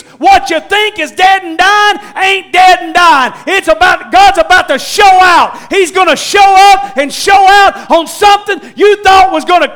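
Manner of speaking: loud, high preaching shout; full-force preaching. Acted. Preacher urging the congregation to keep walking, shouting. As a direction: drive the message home to the church.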